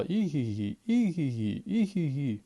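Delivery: high whinnying call, like a horse; zebra's neighing cry. Acted. Zebra calls her friends, a high neighing cry. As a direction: neigh it out, bright and playful. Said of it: "Закричала".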